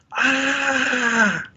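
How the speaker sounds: high, held echo of a scream. A narrator's reading. Mountain voice repeating the son's cry, echoed scream. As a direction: echo the cry back from far off. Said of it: "hears a voice repeating somewhere in the mountains".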